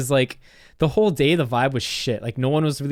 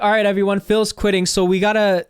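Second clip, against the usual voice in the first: higher-pitched imitation of a boss's announcement; announcing to the store; 6 semitones higher, 4 dB louder than usual. Boss telling the whole store about a quitter, mock announcing. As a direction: address the whole shop, raised pitch. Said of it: "she said it to the whole fucking store".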